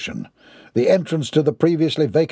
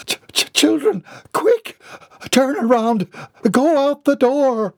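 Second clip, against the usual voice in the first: high, stammering alarm; stammer, then urge in short bursts; panicked nun; 12 semitones higher than usual. A narrator's reading. The nun shields her children, panicked.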